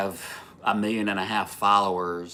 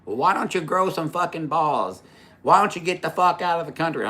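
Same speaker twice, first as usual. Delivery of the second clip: retold angry taunt; 7 semitones higher than usual. Acting out the angry driver.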